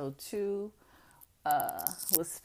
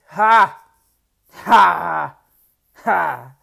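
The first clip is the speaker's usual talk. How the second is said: three loud, separate mock laughs; exaggerated scornful ha; loud scoffing laugh; 21 dB louder, much slower than usual. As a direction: bark out a derisive "Ha!". Scoffs at those underestimating her.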